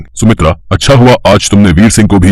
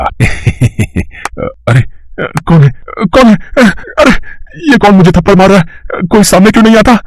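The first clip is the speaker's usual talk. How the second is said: shrill, much higher frightened yelps; startled panic; 11 semitones higher than usual. Acted. Thief slapped by an unseen hand, high panicked cries. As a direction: jump out of your skin at unseen slaps.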